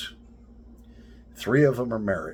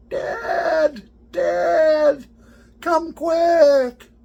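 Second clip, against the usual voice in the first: much higher and louder, a frantic cry for Dad; panicked calling; 9 dB louder, much slower than usual. Retold or imitated. Daughter screaming for her dad to come, panicked. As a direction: yell for Dad like you're terrified.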